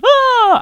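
loud, very high imitated scream; high open "ah" shriek; crowd scream. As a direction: let out the crowd's mock scream. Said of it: "everybody going".

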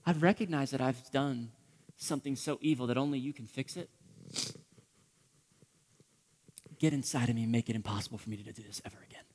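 soft confession ending in a whisper; hushed confession. Acted. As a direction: confess quietly, then whisper the last plea. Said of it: "Do you hear what he's saying".

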